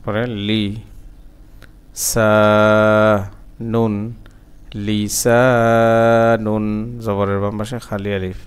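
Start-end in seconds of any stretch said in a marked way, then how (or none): 0.5-3.9 separated syllables with long pauses. Shown as a demonstration. Teacher breaking a word down for learners, syllable by syllable. 4.9-6.6 slow chant, syllables linked; joined recitation. Shown as a demonstration. Teacher putting the word back together, slow joined chant.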